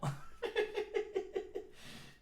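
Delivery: high, breathless run of laughter; wheezy laugh. Real, not acted. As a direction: laugh at your own story. Laughs at own story.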